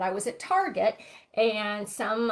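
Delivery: normal talking voice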